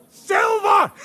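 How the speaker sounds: high, loud cry of triumph; gleeful shout of victory; triumphant shout. Acted. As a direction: shout it out as the treasure opens. Captain reveals the treasure.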